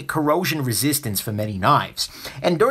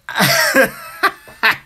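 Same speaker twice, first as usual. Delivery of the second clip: rising, bursting real laugh; loud, flustered laugh; embarrassed laugh; 9 semitones higher, 5 dB louder than usual. Real, not acted. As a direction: caught off guard and laughing. Embarrassed by a fiancé question.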